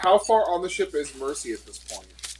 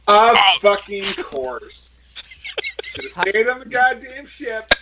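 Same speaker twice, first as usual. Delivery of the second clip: loud, fed-up swearing; furious at the dice and the ship; exasperated outburst; 4 semitones higher, 9 dB louder than usual. Real, not acted. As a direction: vent it loud at the bad luck. Frustrated player venting at bad luck.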